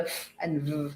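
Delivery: "f" as bare breath, "v" buzzed with voice; unvoiced then voiced sound. Shown as a demonstration. Teacher showing voiced and unvoiced consonants, "f" breathed and "v" buzzed. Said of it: "voiced and unvoiced consonants".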